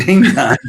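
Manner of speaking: sing-song high ding dong like a doorbell; playful doorbell imitation; imitating a chime. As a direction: mimic the laptop chime, lightly. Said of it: "it'll go ding dong".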